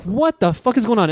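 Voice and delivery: startled alarm. Retold or imitated. Hearing ruckus in church.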